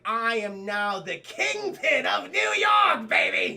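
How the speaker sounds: slow, loud triumphant shout; very excited. Acted. Hobgoblin takes over New York, very excited shout. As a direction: build to a booming, gloating proclamation. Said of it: "He's very excited".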